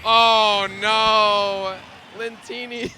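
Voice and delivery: long, high, very loud "oh no"; shocked wail. Real, not acted. Caster reacting to Lentini's disaster, loud drawn-out dismay. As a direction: wail it in amused disbelief, then drop the volume.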